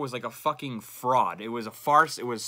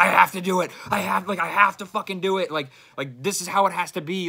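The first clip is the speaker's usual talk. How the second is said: louder, higher annoyed retort; angry insisting; 7 semitones higher, 8 dB louder than usual. Retold or imitated. Answering friends who questioned him, irritated insistence. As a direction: snap back defending your habit, mock-pissed. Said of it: "I'd get fucking pissed and be like".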